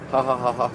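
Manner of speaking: spoken, evenly spaced ha-ha; dry fake chuckle on one level; mock laugh. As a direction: laugh deliberately without real laughter. Mock laugh on getting the joke.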